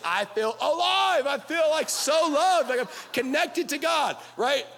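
high, drawn-out hammed-up bliss; mock ecstasy. Preacher sarcastically claiming to love being screamed at, mock ecstatic.

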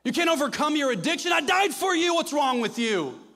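hammed-up angry God scolding a failure; imagined angry Father. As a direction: berate him like a disappointed, furious father. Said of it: "the Father looking at me saying".

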